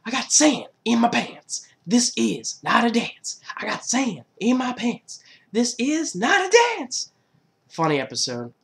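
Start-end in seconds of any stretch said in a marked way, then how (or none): 0.0-7.1 loud, high cartoon whine of protest; slow it down, pitch up high and swooping; imitated cartoon kid complaining; Chucky. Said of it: "Chucky gets sand in his pants and he's like".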